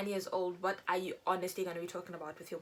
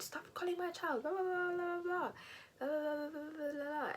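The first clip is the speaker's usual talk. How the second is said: furious mom; 9 semitones higher than usual. Retold or imitated. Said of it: "He said".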